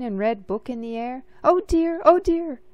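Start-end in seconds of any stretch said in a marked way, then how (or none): 1.4-2.0 high, loud fretful cry; flustered cry. A narrator's reading. Mister Pudding rushing up with the book, flustered. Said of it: "he cried". 2.0-2.6 high, loud fretful cry with a falling end; flustered cry. A narrator's reading. Mister Pudding rushing up with the book, even more flustered. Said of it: "he cried".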